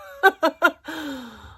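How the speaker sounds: a loud, genuine laugh breaking out; real burst of laughter. Real, not acted. Streamer laughing at the caller's rant, loud real laugh. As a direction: laugh out loud at how ridiculous he is.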